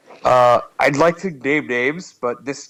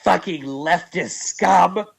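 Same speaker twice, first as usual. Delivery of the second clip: slow mock sneer; 5 semitones higher, much slower than usual.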